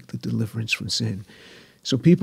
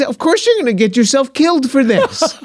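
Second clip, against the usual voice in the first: much higher and louder, an indignant mother ranting; mother's voice; 13 semitones higher, 7 dB louder than usual. Retold or imitated. Mother giving her verdict on the New Testament, high indignant rant. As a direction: imitate his mother fuming at Jesus. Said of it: "She says".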